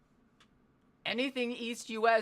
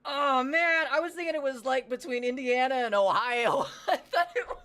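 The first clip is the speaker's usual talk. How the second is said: real surprise, higher and louder; shocked shout; 6 semitones higher, 6 dB louder than usual. Real, not acted. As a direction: cry out in disbelief at the map. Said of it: "that's not the right accent at all".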